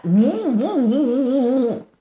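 拖长的呜呜风声，较慢; 学兵刃舞动带起的呼呼风声; 模仿兵器风声. Acted. 兵器舞动带风. (慢慢模仿兵器呼啸声)

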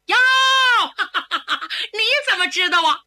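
aunt laughs, amazed he knows her nickname; delighted laugh. Acted. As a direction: burst out laughing with joy.

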